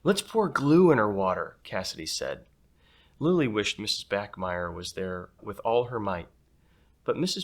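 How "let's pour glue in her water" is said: put-on mischievous girl. Cassidy's mischievous plan.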